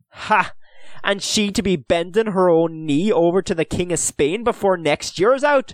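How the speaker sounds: loud, high-pitched scornful comeback; defiant retort. A narrator's reading. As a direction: throw it back with scorn. Owen retorts defiantly to Dillon.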